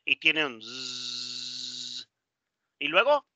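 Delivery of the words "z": "z" held as a long, low voiced buzz; sustained buzzing z. Shown as a demonstration. Teacher isolating the sound in "is", sustained buzzing z. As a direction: hold the "z" and let it hum on and on. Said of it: "se pronuncia is".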